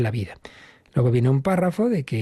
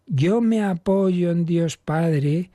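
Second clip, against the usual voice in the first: slow, higher, leaning on each word; heartfelt trust; 5 semitones higher, much slower than usual. Shown as a demonstration. Priest showing how to pray the creed with trust. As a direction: say it as a real act of trust. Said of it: "sino es ese sentido de".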